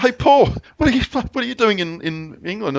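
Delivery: surprised greeting. Retold or imitated. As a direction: call out to an old friend you never expected to see. Greeting old colleague unexpectedly.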